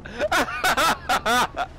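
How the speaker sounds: gasping oh through laughter; can't stop laughing; laughing while talking. Real, not acted. Breaking up laughing at clip.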